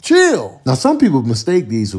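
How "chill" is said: high, loud startled squeal; startled by a bug, yelping high. Real, not acted. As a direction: jump and squeal. Startled by crane fly.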